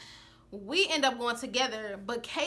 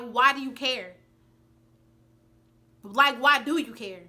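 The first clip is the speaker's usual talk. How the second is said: louder, slower retort; confronting; 5 dB louder than usual. Retold or imitated. Pressing the friend about the hairbrush, loud and slow. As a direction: throw it back at her firmly.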